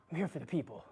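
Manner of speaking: whispered, out of breath; barely any voice left, air only; breathless whisper. Real, not acted. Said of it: "Should probably stop talking".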